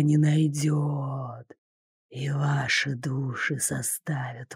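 low, slow, whispering spirit voice; gloating ghost. A narrator's reading. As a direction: promise eternal torment like a ghost. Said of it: "заранее торжествовал призрак".

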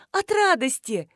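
high, tearful voice; acted happy sob; sobbing for joy. A narrator's reading. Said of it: "всхлипнула Нинуша". (всхлипывая от радости)

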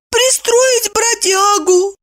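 very high, slow sheepish voice; mumbling, sheepish wife. A narrator's reading. Wife admits her promise to husband, meek and high. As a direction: answer meekly in a tiny high voice. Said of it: "промямлила Евдокия".

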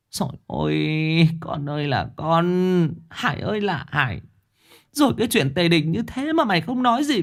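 1 distraught wail. A narrator's reading. Mother wails at son.

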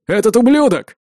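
high, forceful angry shout; shout, accusing the traitor; angry cry. A narrator's reading. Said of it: "крикнул Арви". (гневно выкрикивает)

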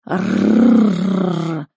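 loud rolled animal growl; long, rattling beast snarl; guttural predator growl. Acted. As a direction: growl from the throat like a big cat. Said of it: "раздался гортанный рык хищника".

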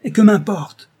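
defiant shout, rising high; explosive, ringing anger; ringing outburst. A narrator's reading. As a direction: burst out loudly in scorn. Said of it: "d'une voix éclatante".

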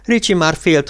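plain reading voice

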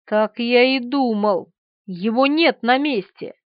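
usual speaking voice

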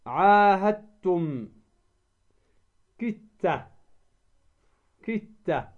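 "عاهدتم" as slow "ahat-tum", "كت" as "kit-ta"; merged recitation. Shown as a demonstration. Teacher recites examples of merging, د into ت. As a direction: hold the doubled t in "عاهدتم" and "كت". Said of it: "فتدغم الدال في التاء".

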